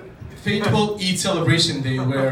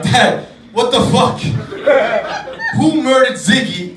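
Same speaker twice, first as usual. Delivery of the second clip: loud, higher, hammed-up outrage; mock outraged yell; 5 semitones higher, 6 dB louder than usual. Boy yelling at his father over the goat, mock outrage. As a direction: yell at dad like a betrayed kid. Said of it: "I'm like".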